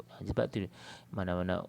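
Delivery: ordinary delivery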